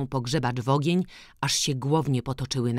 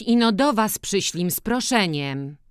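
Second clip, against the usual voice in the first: timid; 7 semitones higher, 4 dB louder than usual. A narrator's reading. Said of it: "rzekła nieśmiało Kowalowa".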